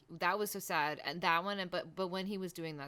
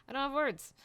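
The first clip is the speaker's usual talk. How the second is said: high, louder exclamation; overwhelmed; 9 semitones higher, 6 dB louder than usual. Real, not acted. Host overcome by the sad scene, high-pitched. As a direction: pitch jumps up in helpless emphasis.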